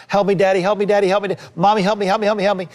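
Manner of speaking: high-pitched mock frantic kid pleading; rapid-fire, high, whiny pleading like a panicking child; hysterical child. Preacher acts out a child begging parents, mock hysterical. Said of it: "they're just hysterical and they're crying".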